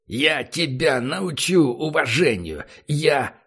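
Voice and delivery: slow, furious word-by-word threat; angry. Acted. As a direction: hammer each word in rage. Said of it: "Выделяя каждое слово".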